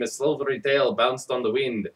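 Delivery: ordinary delivery, nothing marked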